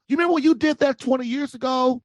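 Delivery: a lightly hammed-up mad wife. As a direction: imitate the wife throwing an old grudge back. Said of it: "she gets mad at you and goes".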